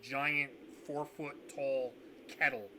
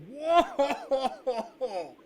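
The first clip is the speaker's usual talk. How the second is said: loud, higher, laughing whoa; NPC's whoa; 11 semitones higher, 11 dB louder than usual. Acted. GM showing the host's habit to the players, loud laughing whoa. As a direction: belt it out like his catchphrase. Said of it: "ends every sentence with".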